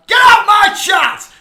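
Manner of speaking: screaming high and loud at a commenter; furious shout. Real, not acted. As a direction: throw him out of the chat at full volume.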